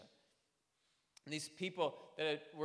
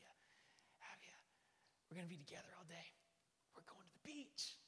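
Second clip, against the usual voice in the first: put-on hushed eager whisper to a child; excited whisper; 3 semitones higher, 16 dB quieter than usual. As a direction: whisper it so no one else wakes. Dad whispering to waking daughter.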